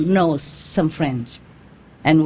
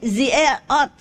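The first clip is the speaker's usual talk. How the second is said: higher, mock French accent, laughing through it; 7 semitones higher than usual. Frenchman correcting the agent, higher mock French accent. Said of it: "the Frenchman said".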